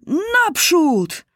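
high, drawn-out rallying yell; battle-charge shout. A narrator's reading. Soldiers charging out of the trenches, high rallying cry. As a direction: call the troops to charge, pitched high.

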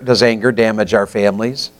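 ordinary talk, nothing marked

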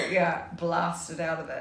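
usual speaking voice

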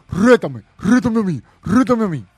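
mocking whiny plea. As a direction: nag it like a needy champ. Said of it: "no anda diciendo".

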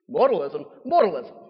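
loud mock-Irish exclamation; lightly put-on Irish leprechaun, loudly; bad Irish accent. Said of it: "my terrible Irish accent".

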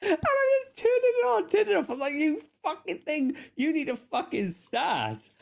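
squeaky, high-pitched mock rage; cartoonishly high, exasperated voice; exasperated high-pitched rant. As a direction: shriek it at the car in comic frustration. Retelling car trouble, very high.

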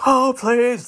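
high-pitched mock wail; mock crying. Host showing how they didn't cry, hammed-up wailing. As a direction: exaggerate a pleading, tearful cry. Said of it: "I came home and cried".

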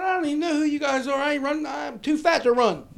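high, loud mock indignation; indignant protest. Arrested drunk yelling his innocence at the cops, loud and high. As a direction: insist you're innocent, loudly.

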